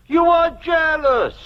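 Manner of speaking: loud accusing cry; slow, high accusation; shout. Acted. Uncle accuses nephew.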